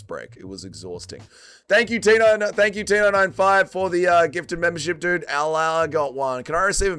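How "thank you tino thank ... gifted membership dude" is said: high, loud shout-out; hyped thanks. Real, not acted. Streamer thanking a viewer for gifted membership, high and hyped. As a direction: call out the donor with energy.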